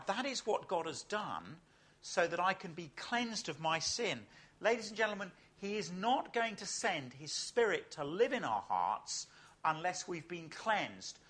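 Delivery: ordinary talk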